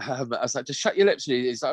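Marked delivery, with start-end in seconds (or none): 0.7-1.2 stern. Retold or imitated. Telling noisy son off.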